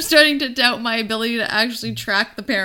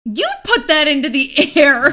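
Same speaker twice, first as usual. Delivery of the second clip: laughing; 3 semitones higher, 6 dB louder than usual. Real, not acted. Laughing echo of accusation.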